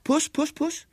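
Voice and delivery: very high, coaxing call; calling a cat. Acted. William beckons the strange cat, high coaxing call. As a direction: coax it over in a tiny high voice.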